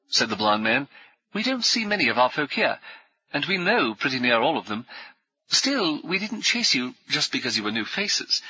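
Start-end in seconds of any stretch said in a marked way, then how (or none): 1.3-8.4 brisk, higher, jovial military gent; hearty ex-officer. Acted. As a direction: bluff old soldier greeting new friends. Brummond explains.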